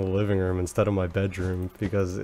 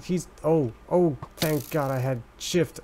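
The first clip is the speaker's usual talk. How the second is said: ordinary talk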